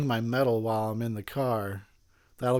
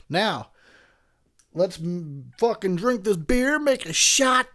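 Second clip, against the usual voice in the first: loud rallying cry, rising higher; hyped up; 7 semitones higher, 4 dB louder, slower than usual. Real, not acted. Drinker rallying viewers for beer and a shot, hyped up. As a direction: whoop it up, building to a shout.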